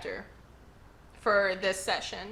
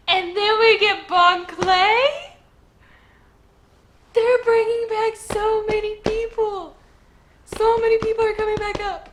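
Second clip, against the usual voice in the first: high, loud gushing delight; real thrilled singsong; excited; 11 semitones higher, 8 dB louder than usual. Real, not acted. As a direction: bubble over with fan joy. Thrilled recap of returning characters.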